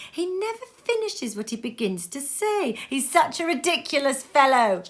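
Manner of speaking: high, laughing delighted wife; delighted niece. Acted. Niece clapping at her husband, high and laughing. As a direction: tease him fondly. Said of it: "clapping her hands".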